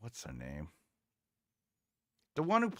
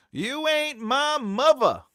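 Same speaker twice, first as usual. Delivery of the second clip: higher, louder and slow, in an imitated London accent; mock Cockney quote; 10 semitones higher, 7 dB louder, slower than usual. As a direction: belt it out as a Cockney EastEnders line. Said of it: "British slang will say this".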